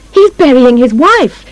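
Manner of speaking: high, alarmed exclamation; crying out. A narrator's reading. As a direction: cry it out to the others. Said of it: "Missus Carp cries".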